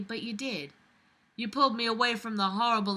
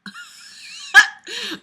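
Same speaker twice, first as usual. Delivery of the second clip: high, loud real laugh breaking out; burst of laughter; 8 dB louder than usual. Real, not acted. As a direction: crack up at the absurd sentence. Bursts out at absurd line.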